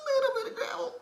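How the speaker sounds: very high squeaky voice, slight grain; high gravelly cartoon squeak. Shown as a demonstration. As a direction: squeeze it up high with a little gravel. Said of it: "It's just up high".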